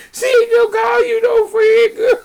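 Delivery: high, loud laughing cry; laughing shout. Real, not acted. Laughing shout to a friend over the client story. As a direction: shriek it through laughter.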